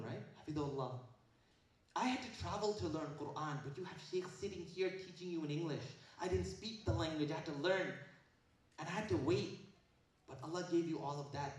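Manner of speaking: ordinary delivery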